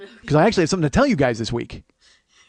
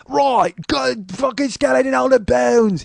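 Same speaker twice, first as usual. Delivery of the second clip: high, slow, hammy Cockney; bad radio Cockney, stretched out and pitched up; overselling Cockney; 7 semitones higher, 4 dB louder, much slower than usual. Cockney radio actor overselling the skeleton, hammed-up Cockney. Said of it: "there was a Cockney guy in it".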